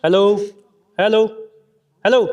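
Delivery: hello called down a well, echoing. Real, not acted. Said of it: "সেটা একবার শোনো".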